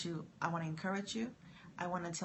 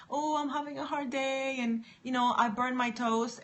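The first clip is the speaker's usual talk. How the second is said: higher, louder mock whine; mock complainer; 5 semitones higher, 4 dB louder than usual. Mocking someone complaining about their day, mock whining. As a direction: ham up a self-pitying moaner. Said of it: "complain to somebody else".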